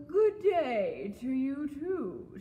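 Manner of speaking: slow, loud, swooping crocodile voice; lazy crocodile. A narrator's reading. Crocodile greets frog on riverbank, slow drawl. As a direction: draw out the greeting lazily.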